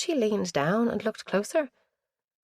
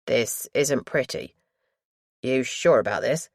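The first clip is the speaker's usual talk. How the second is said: put-on lower, careful warning from the young attendant; gently pleading lad; 5 semitones lower than usual. A narrator's reading. Mortuary lad warns visitor before viewing, low and careful. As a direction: lower the voice and check she is ready. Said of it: "looked pleadingly at Asha".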